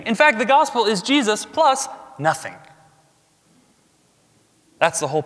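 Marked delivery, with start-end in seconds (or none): 0.0-2.5 climactic emphasis. Real, not acted. Preacher driving home the point to the church. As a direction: pitch jumps up on nothing. Building contrast in sermon.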